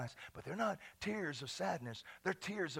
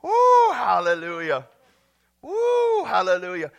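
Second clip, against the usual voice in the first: loud, drawn-out praise whoops; exultant whooping; 2 semitones higher, 16 dB louder than usual. Real, not acted. Pastor after laughing fit, exultant whooping hallelujah.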